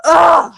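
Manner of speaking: loud exasperated ugh; loud groan. Real, not acted. Groan before answering.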